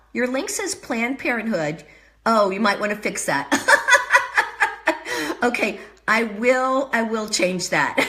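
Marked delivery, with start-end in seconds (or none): 3.5-5.4 loud, high laugh of surprise; burst of laughter. Real, not acted. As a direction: laugh out loud, caught off guard. Reacting to the wrong link.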